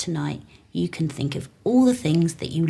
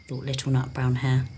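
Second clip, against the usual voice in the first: ordinary delivery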